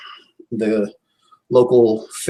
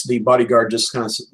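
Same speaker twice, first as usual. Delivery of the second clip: ordinary talk, nothing marked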